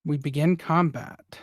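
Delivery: ordinary talk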